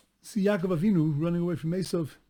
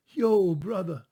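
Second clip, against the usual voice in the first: louder, easygoing street greeting; offhand "Yo, brother" with a casual, friendly punch; casual modern slang; 3 semitones higher, 7 dB louder, slower than usual. Retold or imitated. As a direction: toss it off like greeting a buddy today. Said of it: "We talk today, you know, the sprach is".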